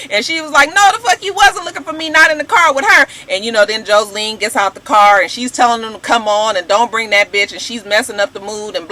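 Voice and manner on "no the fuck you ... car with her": much higher, louder angry retort; imitating Mimi yelling at Stevie J; mock yell. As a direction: shut him down, loud and high.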